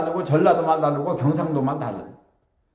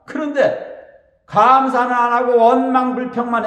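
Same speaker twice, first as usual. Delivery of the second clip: raised warning; 6 semitones higher, 6 dB louder than usual. Real, not acted. Preacher warns congregation.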